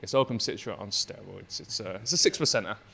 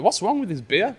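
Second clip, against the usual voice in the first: puzzled; 10 semitones higher, 12 dB louder than usual. Retold or imitated. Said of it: "looking at the label, like".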